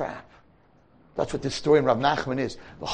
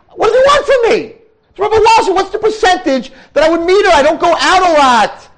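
much higher and louder, incredulous; exasperated boy; 10 dB louder than usual. Retold or imitated. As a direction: throw up your hands at fate. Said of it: "He says".

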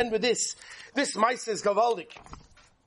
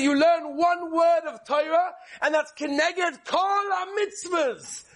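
preaching cry; 6 semitones higher, slower than usual. Real, not acted. Preacher's high exclaiming climax.

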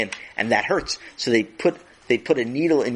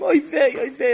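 high wailing oy vey; fretting mother; 4 dB louder than usual. Acted. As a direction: moan it like a worried mother. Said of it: "his mother went over to him".

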